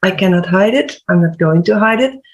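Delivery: normal talking voice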